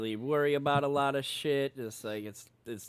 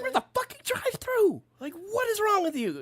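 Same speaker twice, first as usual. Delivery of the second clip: much higher, incredulous scolding; hammed-up disbelief at the kid; high mock exasperation at Little B; exasperated. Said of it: "I look at him again, like".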